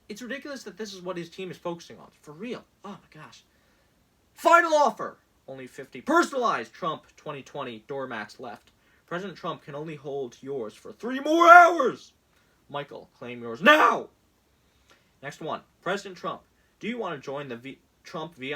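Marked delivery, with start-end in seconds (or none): 4.3-14.1 loud, pushy sales-pitch voice; mock campaign ad announcer; hammed-up ad read. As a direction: sell it like a cheesy infomercial. Reading Trump campaign text aloud.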